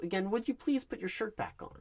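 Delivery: losing patience, asking a second time; exasperated repeat. Shown as a demonstration. Interviewer asking the candidate again to dress, exasperated. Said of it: "as I mentioned".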